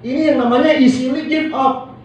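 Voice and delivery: normal talking voice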